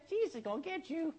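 high, soft parrot call. Acted. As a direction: squawk it quietly like a talking parrot. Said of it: "this parrot goes".

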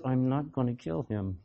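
a wife swallowing her rage, quiet and low; wife holding back. Retold or imitated. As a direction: clamp down on the fury, barely voiced. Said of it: "the wife goes".

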